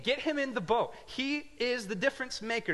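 normal talking voice

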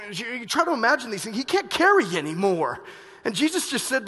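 loud fervent sermon peak; fiery preaching. Acted. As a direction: preach it hard to the congregation. Preacher builds to full force.

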